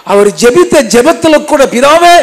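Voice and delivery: high, loud cry to the Father; fervent preaching. Acted. Preacher quoting Jesus' prayer to the congregation, high and loud. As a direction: lift it up with preaching fervour.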